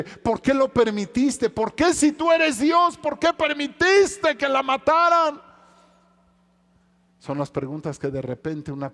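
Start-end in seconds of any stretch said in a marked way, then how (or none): 0.0-5.4 higher, louder, slow anguished cry; a father who lost his daughter crying out; anguished plea to heaven; grieving father's cry. Acted.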